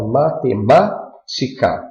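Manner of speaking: "matemática" broken up with "má" punched; stress on antepenultimate syllable. Shown as a demonstration. Teacher showing a proparoxytone, stressed "má" in "matemática". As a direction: hit "má" in "matemática", then set "tica" apart. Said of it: "a sílaba tônica recai na antepenúltima sílaba".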